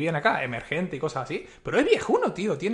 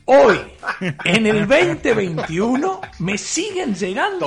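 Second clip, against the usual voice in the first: much louder and higher, half laughing in disbelief; exasperated laughing outburst; 5 semitones higher, 8 dB louder, slower than usual. Real, not acted. Telling co-hosts emails still arrive, loud laughing disbelief. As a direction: blurt it out, amazed it still happens.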